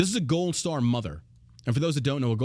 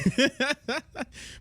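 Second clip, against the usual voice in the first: real laugh jumping much higher; high laugh burst; 13 semitones higher than usual. Real, not acted. Laughs at co-host's joke.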